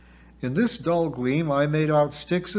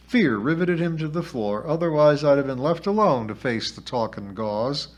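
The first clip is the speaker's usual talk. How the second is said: ordinary delivery, nothing marked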